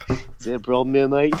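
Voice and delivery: cartoonish put-on Australian accent; hammed-up Australian accent; Rocco. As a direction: repeat it as a mock Australian cartoon voice. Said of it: "I just sounded like Rocco".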